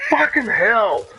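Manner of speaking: loud furious curse; angry shout. Real, not acted. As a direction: yell it out in real anger. Shouted at enemy attack.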